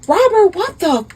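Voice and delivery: acted high-pitched shock; shocked outburst. Wife catching her husband cheating, shocked.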